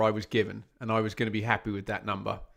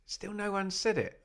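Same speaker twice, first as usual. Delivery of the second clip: high, soft teasing tone; teasing sing-song; 7 semitones higher, 6 dB quieter than usual. Real, not acted. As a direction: tease the chat gently, pitched up. Teasing chat again, pitched up.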